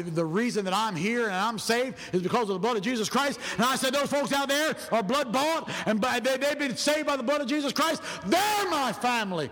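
high, driving sermon cadence; rising preaching. Real, not acted. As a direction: build to a high, fervent declaration. Preaches at full force.

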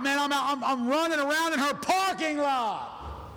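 high, whooping retold elation; wildly excited. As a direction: cry it out high, stretching the last words. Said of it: "I started getting excited".

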